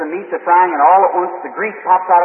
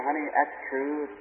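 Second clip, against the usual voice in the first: soothing wife; 3 semitones lower, 12 dB quieter than usual. Retold or imitated. Soothing his upset wife.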